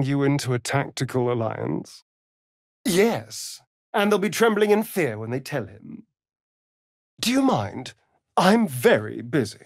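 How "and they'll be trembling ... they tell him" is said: ordinary talk, nothing marked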